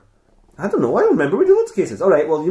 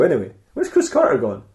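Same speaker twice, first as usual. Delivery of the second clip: ordinary talk, nothing marked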